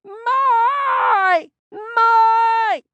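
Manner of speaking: very high shouted calls, voice nearly cracking; voice-breaking yell. A narrator's reading. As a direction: scream her name till the voice almost breaks. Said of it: "nearly breaking her voice with yelling".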